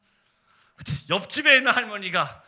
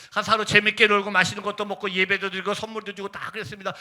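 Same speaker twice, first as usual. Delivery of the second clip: usual speaking voice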